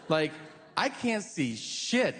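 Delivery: usual speaking voice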